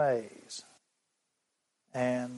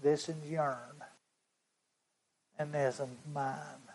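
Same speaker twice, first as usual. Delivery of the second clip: much softer take on "This is yourn"; the boy's words, hushed; 8 dB quieter than usual. Retold or imitated. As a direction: drop it right down, tender and small. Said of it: "That's how he said it".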